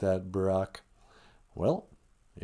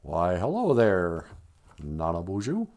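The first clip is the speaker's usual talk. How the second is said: slow, lower, measured presidential voice; lightly voiced Obama, slow and low; slow low Barack voice; 6 semitones lower, 4 dB quieter than usual. Barack puppet voice.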